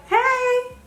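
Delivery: lightly voiced mom answering the phone; mom's greeting. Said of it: "And she was like".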